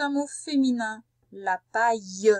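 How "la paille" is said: "La paille" said slowly, every sound drawn out; slow dictation. Shown as a demonstration. Teacher repeating "La paille" for the pupil, slow and deliberate. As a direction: stretch out "La paille" so the pupil can write it. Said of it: "Allez, écoute bien".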